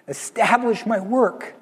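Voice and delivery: high, strained plea from the cross; suffering. Acted. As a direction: plead it as if crowned with thorns. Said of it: "crown of thorns on his head and nails".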